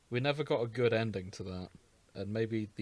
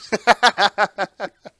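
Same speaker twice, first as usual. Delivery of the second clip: high burst of helpless laughter; loud laughing fit; 9 semitones higher, 15 dB louder than usual. Real, not acted. Host cracking up at her own brown note joke, loud laughter.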